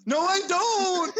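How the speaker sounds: high, loud, drawn-out wail; hammed-up tearful outcry through laughter; breaking down. Real, not acted. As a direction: protest in comic despair. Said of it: "Now he's just breaking down".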